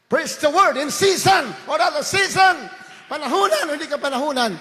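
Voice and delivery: loud, high shouted sermon; fiery preaching. Acted. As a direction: preach it out at full force. Preaching at full force. (sumisigaw na pangangaral)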